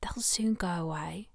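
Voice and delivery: soft unvoiced whisper. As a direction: whisper it tenderly. Lovers whispering in bed.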